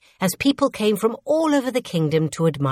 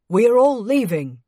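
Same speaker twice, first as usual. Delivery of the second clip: raised, projected guard's voice; urgent announcement; 5 semitones higher, 4 dB louder than usual. A narrator's reading. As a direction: call out the evacuation order. Guard calls evacuation to servants.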